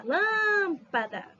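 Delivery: LÂM in "lâmpada" held long and high; stressed first syllable. Shown as a demonstration. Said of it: "A forte é a antepenúltima".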